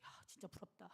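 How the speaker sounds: whispered envy to herself; inner whisper. Retold or imitated. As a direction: breathe it under your breath. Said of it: "속으로".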